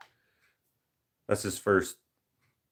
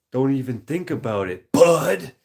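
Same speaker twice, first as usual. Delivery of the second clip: higher, louder punch on bud; movie quote; 6 semitones higher, 8 dB louder than usual. Retold or imitated. Streamer mocking the odd movie line, loud bud. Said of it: "Such a weird inflection".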